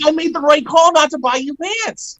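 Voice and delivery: very high-pitched gloating; mock triumph. Parent to kid with split khakis, comically triumphant. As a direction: crow it way up high.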